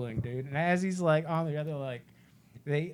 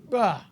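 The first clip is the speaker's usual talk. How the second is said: mock jump-scare bah; 7 semitones higher, 11 dB louder than usual.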